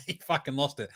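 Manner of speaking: ordinary talk, nothing marked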